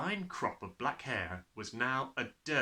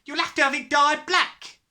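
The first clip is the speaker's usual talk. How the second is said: high, raised mother's voice; loud telling-off; 11 dB louder than usual. A narrator's reading. Mother instructing her husband, loudly. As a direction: give the verdict loudly. Mother answers in family row.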